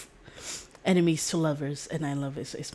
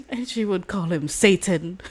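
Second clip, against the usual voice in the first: ordinary delivery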